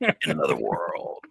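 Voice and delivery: slow whispered movie trailer voice. Retold or imitated. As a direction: breathe it like a dramatic trailer. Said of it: "trying to do the trailer voice".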